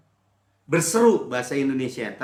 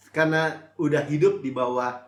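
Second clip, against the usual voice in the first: ordinary talk, nothing marked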